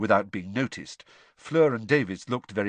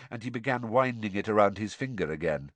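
usual speaking voice

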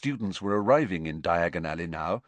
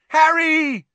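loud, very high drawn-out holler; yelling his name; 10 dB louder than usual. A narrator's reading. Friends waving frantically at Harry, yelling his name. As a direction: yell for a friend far down the street. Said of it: "someone yelled his name".

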